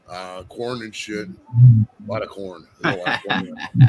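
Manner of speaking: low, slow chuckling put-down of Iowa; mocking laugh. Host mocking Iowa to guests, low slow laughing drawl. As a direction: drag it out low and laugh through it.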